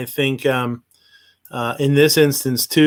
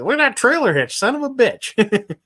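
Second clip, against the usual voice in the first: mock viewer reaction; 11 semitones higher than usual. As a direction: exaggerate it in a silly high voice. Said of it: "that's what Mad Sexy's getting out of this".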